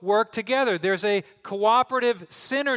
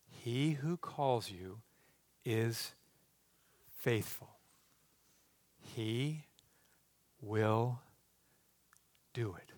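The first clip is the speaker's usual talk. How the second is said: very soft and low, a pause between almost every word; slow solemn repetition; 10 semitones lower, 13 dB quieter, much slower than usual. Real, not acted. Preacher repeating the promise so they hear him, slow and hushed. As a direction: drop to a hush and set down each word separately.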